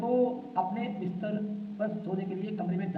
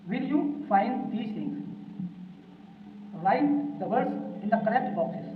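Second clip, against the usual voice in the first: very slow, word-by-word dictation; teacher dictating the instructions slowly; slow reading aloud; much slower than usual. Shown as a demonstration. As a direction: read it out slowly for the class to follow. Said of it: "teacher reads instructions slowly".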